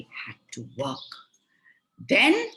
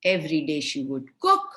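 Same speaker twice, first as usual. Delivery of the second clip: plain reading voice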